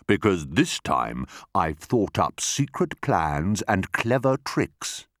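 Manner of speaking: slow, savoured boast; smug, deliberate crocodile; scheming enormous crocodile. Acted. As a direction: relish each word of the plan. Crocodile boasts of his plans.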